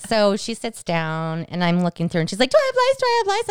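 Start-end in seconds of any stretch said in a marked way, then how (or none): 2.5-3.5 very high, panicked squeal, imitating a child; mock frantic pleading, pitched way up; hysterical daughter. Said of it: "she's hysterical".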